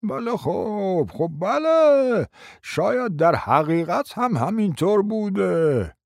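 slow, hesitant mumble; mumbling under his breath. Acted. Timid man mumbling agreement under his breath. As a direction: drag it out under your breath. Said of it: "زیر لبی گفت".

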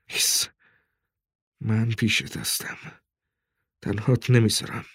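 soft, tender murmur; hushed reassurance. A narrator's reading. Father promising child he will stay, hushed. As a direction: soothe gently, keeping it down. Parent soothing frightened child.

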